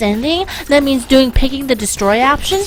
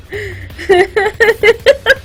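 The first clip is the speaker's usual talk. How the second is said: higher, rising burst of laughter; real laughter; 6 semitones higher than usual. Real, not acted. Laughing at herself after ranting to viewers. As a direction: laugh at her own rant.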